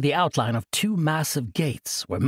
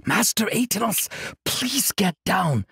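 urgent call; 7 semitones higher than usual. Acted. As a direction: hiss him down urgently. Kasai urges monk to hide.

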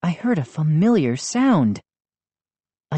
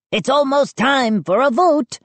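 eager gnome; 9 semitones higher, 3 dB louder than usual. Acted.

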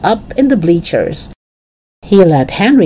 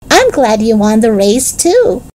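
put-on high-pitched child, lifting at the end; admiring little boy; 6 semitones higher than usual. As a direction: speak as a pleased small boy. Little Benny admires his brother.

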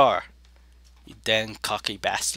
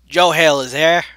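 higher, louder, poorly done impression; bad Joe Hill; 4 semitones higher, 9 dB louder than usual. Player mimicking someone's arrival line, badly faked. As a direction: announce yourself in a half-baked impression. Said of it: "that's a bad Joe Hill s-, impression".

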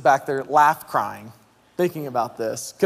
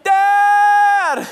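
put-on loud, very high kid's yell; excited child's shout; 8 dB louder than usual. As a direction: greet your father at full volume, like a kid. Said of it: "they are arms open up wide".

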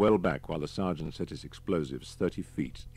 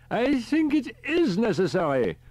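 put-on high, fussy elderly professor; a high old professor calling on the radio; professor's voice; 8 dB louder than usual. A narrator's reading. Riker on the radio.

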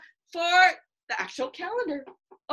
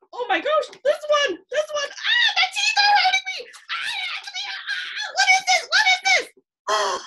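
high, loud pretend yelps; mock pain shout; 5 dB louder than usual. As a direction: ham up being bitten. Pretend alligator bites teacher.

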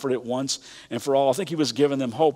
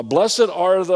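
hesitant, higher and louder, trailing off; fumbling start; 5 semitones higher, 6 dB louder, much slower than usual. Pretend. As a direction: start the verse and lose it. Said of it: "I don't think that Jesus was like that".

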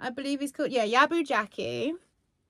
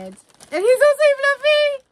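very high, loud, slow squeal; excited squeal; 13 dB louder than usual. Real, not acted. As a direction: squeal over the second fluffy toy. Second plush revealed.

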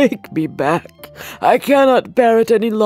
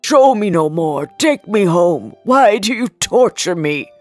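pleading loudly; 6 dB louder than usual. Acted. Scrooge begs the ghost.

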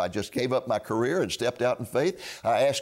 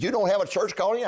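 incredulous; 8 semitones higher than usual. Retold or imitated. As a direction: ask as if you can't believe your ears. University president hears surprising news.

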